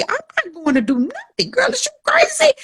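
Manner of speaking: high, indignant disbelief; incredulous refusal. Retold or imitated. Refusing the girl on the corner, high and incredulous. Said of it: "I'm naturally".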